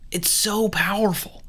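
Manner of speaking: hushed whisper. Acted. Host whispers to listeners about touch's power. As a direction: whisper it like a secret.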